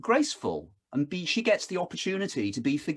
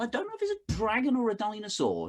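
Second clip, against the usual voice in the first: higher, quick, put-on storytelling voice; playful dad voice; 8 semitones higher, faster than usual. Father playing the tree game with his daughter, mock-wondering. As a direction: tease her with a silly guessing voice. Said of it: "And I said".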